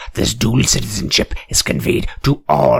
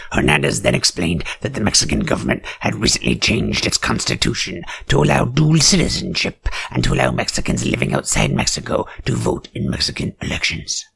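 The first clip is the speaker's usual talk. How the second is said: usual speaking voice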